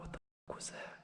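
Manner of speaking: frightened whisper. Real, not acted. Hears a noise, frightened.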